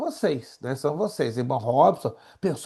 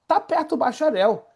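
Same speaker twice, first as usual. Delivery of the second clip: excited encouragement; 9 semitones higher than usual. Real, not acted. Rousing the students.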